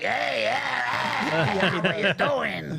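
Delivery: raspy cartoon smoker drawl; high, hammy cartoon-character impression; cartoon cigarette voice. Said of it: "he talks like a cartoon cigarette".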